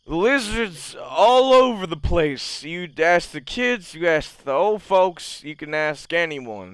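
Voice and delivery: put-on loud, high, slow drunken rant. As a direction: wave your arms and rant like a tipsy local. Drunk ranting about lizards.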